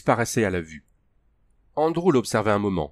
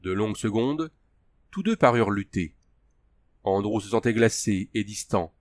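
usual speaking voice

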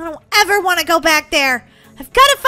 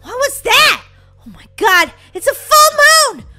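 high, loud frightened exclamations; alarmed; 6 semitones higher, 5 dB louder than usual. Pretend. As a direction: gasp it out in pretend terror. Terrified by howl.

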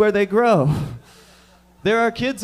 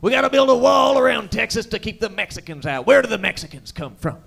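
loud, higher shouting Texan; angry Texan; 5 semitones higher, 5 dB louder than usual. Retold or imitated. Texans ranting about Mexicans, loud angry Texan. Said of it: "They say, like".

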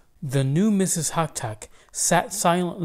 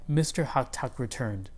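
ordinary delivery

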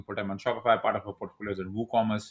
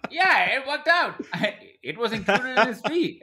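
laughing; 13 semitones higher, 5 dB louder, slower than usual. Real, not acted. Guest laughs off the lucky deal.

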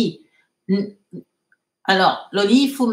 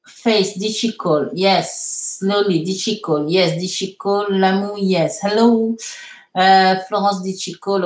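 ordinary talk, nothing marked

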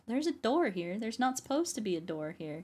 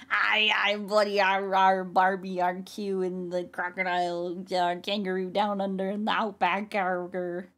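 incoherent Australian. Acted. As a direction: yell garbled, hammed-up Aussie nonsense. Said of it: "He yells in incoherent Australian".

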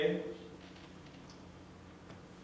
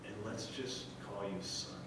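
tender father; 4 semitones lower, 9 dB quieter than usual. Retold or imitated. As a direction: comfort a lost child gently. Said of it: "He says".

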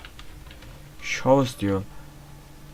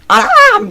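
high yelp of fright; real sudden shriek; startled shriek; 12 dB louder than usual. Real, not acted. Caught by monster.

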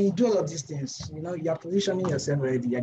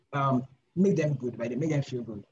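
normal talking voice

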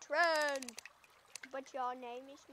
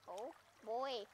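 ordinary talk, nothing marked

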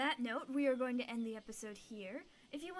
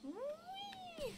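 gleeful whee; 5 dB quieter than usual. Acted. Gibbon swinging again.

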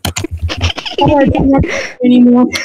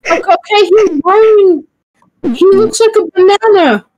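ordinary delivery

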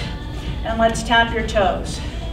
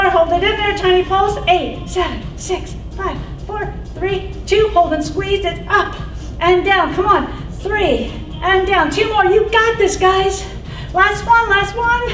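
high, pumped-up counting cues; aerobics instructor; 6 semitones higher than usual. Acted. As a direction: hype the class through every count. Counts final pulses.

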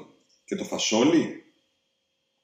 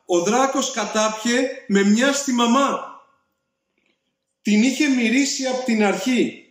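shouting; 8 semitones higher than usual. A narrator's reading. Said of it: "φωνάζει ο Τζακ δυνατά".

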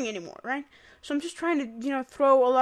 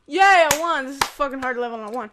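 triumphant cheer; 9 dB louder, faster than usual. Real, not acted. Winning the game level.

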